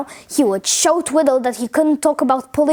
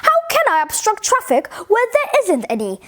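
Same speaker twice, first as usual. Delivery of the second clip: indignant Twiddle; 7 semitones higher than usual. A narrator's reading.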